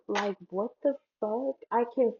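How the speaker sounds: normal talking voice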